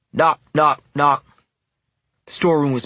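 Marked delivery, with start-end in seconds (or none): 0.1-1.2 three loud, higher, punched knocks; loud knocking. A narrator's reading. Narrator echoes insistent rapping at the storeroom door, loudly. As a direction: hit each knock hard, like insistent rapping. The knocking repeats, more forceful.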